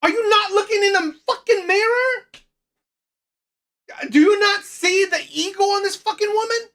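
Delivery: high, loud indignant rant. Real, not acted. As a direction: rant loudly, incredulous. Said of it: "I'm sorry I'm being loud".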